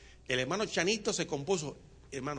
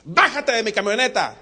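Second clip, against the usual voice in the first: angry order; 5 semitones higher, 10 dB louder than usual. Acted.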